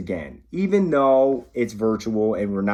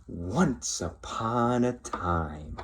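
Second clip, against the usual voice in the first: slow, softer once-upon-a-time; soft storytime opening; 8 dB quieter, slower than usual. Acted. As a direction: ease into the story slowly. Starting a bedtime story.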